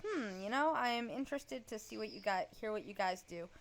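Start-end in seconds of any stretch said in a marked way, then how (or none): none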